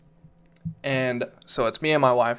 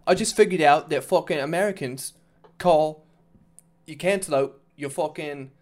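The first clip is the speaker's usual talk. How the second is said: raised, louder British accent imitation; mock British guy; 2 semitones higher, 6 dB louder than usual. Retelling a British guy's cantaloupe video, mock British accent. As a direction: put on an indignant British accent. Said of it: "a British guy saying".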